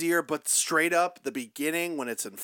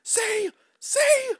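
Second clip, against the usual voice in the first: squeaky see. Pretend. Mock triumph after witch story.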